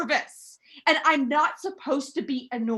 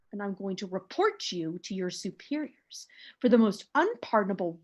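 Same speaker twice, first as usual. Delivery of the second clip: much softer and lower, a menacing hush; an icy whispered warning to the operator; cold quiet threat; 9 semitones lower, 7 dB quieter than usual. Acted. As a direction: hiss the threat low and quiet.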